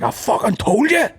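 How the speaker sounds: high put-on angry teacher voice; mock the raging teacher; angry teacher quote. Technology teacher scolding the class, mock angry. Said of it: "the guy, he was like".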